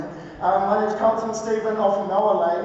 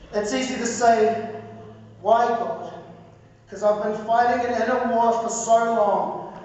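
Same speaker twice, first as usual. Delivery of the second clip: higher-pitched, measured poetry recital with weighted pauses; spoken word poetry; 6 semitones higher than usual. Acted. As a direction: deliver it as a rhythmic spoken word testimony. Performs spoken word poem.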